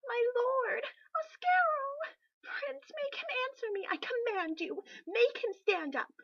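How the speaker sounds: high, loud, panicked shrieking; a frantic queen shrieking her husband's name; hysterical shriek. Acted. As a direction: scream for the fallen king in terror. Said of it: "Ivy shrieked".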